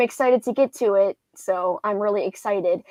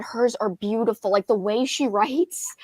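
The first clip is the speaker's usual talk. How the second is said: normal talking voice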